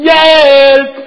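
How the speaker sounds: loud, high cry for help; shout for help. Retold or imitated. Men holding up the falling roof shout loud for help. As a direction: yell it out in panic. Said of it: "Og så råber de".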